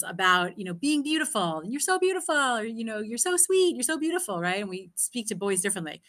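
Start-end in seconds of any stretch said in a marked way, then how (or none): 1.7-2.3 gushing praise. Retold or imitated. Example of gushing praise to girls. 3.0-4.4 gushing praise. Retold or imitated. Example of gushing praise to girls.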